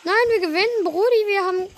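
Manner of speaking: high, loud gamer cheer; excited shout. Real, not acted. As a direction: yell it out in triumph. Cheering a game win.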